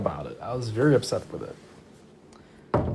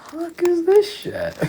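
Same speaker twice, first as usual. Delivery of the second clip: much higher, slow, put-on shocked voice; mock outraged squeal; slower than usual. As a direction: stretch it out in a cartoonish disbelief. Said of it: "And I'm like".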